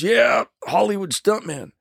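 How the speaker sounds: a hint of a stuntman in pain; injured stuntman. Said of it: "He goes".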